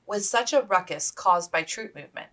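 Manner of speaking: usual speaking voice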